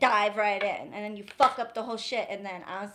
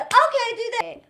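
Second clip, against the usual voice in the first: mock cheery reply; 12 semitones higher, 8 dB louder than usual. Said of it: "And I'm just supposed to".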